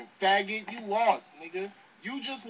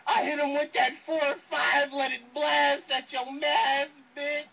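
loud, raised impression of a battle rapper; Hollow; 4 semitones higher, 5 dB louder than usual. Rapper hands his verse to a friend's voice to diss an opponent, loud impersonation. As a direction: punch out the bars higher and louder in another rapper's voice.

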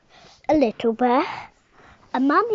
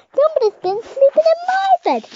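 much higher, loud, fast shout; shouting bear; 10 dB louder, faster than usual. Acted. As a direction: shriek it out in a rush.

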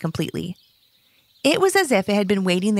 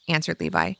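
ordinary delivery

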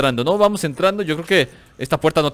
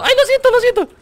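much higher, louder squeaky fake sorry; mock whiny apology; 6 dB louder than usual. Gamer mocking himself after a blunder, squeaky fake apology.

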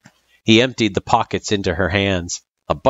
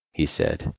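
ordinary delivery, nothing marked

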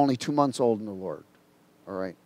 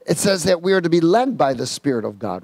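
usual speaking voice

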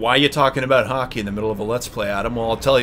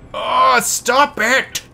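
panicked shout; 11 semitones higher, 3 dB louder, much slower than usual. Real, not acted. Player panics at game.

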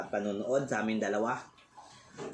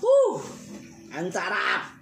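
loud delighted whoop; excited; 10 semitones higher, 7 dB louder, much slower than usual. Real, not acted. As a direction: shout with joy at the taste. Big delighted shout tasting food.

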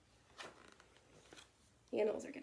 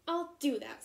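grouchy screech; 5 semitones higher, 5 dB louder than usual. A narrator's reading. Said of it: "screeched the grouchy ladybug".

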